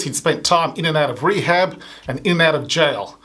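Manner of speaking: ordinary talk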